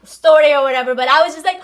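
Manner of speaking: ordinary talk, nothing marked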